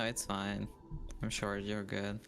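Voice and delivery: normal talking voice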